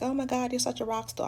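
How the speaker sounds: high, bright-eyed mock praise from the doctor; gushing doctor. Doctor praising the patient's toughness, mock gushing. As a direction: gush like an impressed doctor, voiced lightly.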